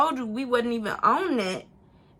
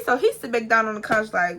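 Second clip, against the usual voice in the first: ordinary talk, nothing marked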